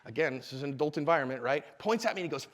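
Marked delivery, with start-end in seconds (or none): none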